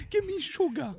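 high British plea with a laugh; begging Brit, half laughing; mock British accent. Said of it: "accent britannique".

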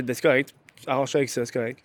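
low, muted dismissal; retold curt reply, soft and low; curt brush-off. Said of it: "je pense que j'ai été trop bête".

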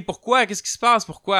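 high, loud panicked questions; frightened shout. Retold or imitated. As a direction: yell back in alarm. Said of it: "elle crie".

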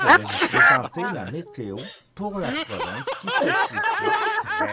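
laughing, put-on weather lady voice; Céline voice. Host presenting the weather as Céline, laughing put-on voice. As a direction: play Céline hosting the forecast, half-giggling. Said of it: "la météo avec, euh, Céline".

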